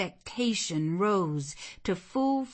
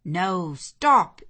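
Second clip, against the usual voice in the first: sudden raised, louder objection; interrupting; 2 semitones higher, 9 dB louder than usual. Acted. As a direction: cut in to halt the order. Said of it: "interrupted Colonel Dent".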